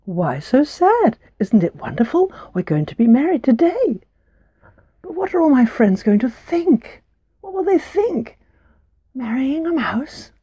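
high, squeaky and eager little mouse; a thrilled little mouse bride; tiny mouse voice. Acted. Mouse bride and Mika talk.

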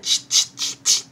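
whispered ch-ch chatter; mock him like monkeys. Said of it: "the monkeys ... said".

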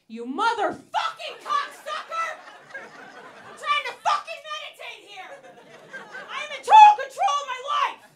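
road rage screaming. Retold or imitated. Driver yelling at a guy who pulled in front, furious screaming.

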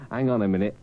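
usual speaking voice

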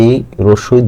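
ordinary talk, nothing marked

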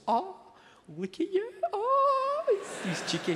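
put-on high cooing baby talk. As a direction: coo at the baby in a sky-high voice. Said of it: "they can just go".

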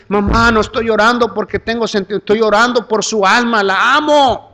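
retold plea rising on the last words; tearful pleading. Son pleading for his mother's soul, retold tearful insistence. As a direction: plead insistently, ending on a high cry of love.

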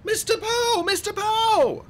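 put-on very high, loud child's call; high girlish holler. A narrator's reading. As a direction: call out for his attention, drawn out. Girl calling out to an adult.

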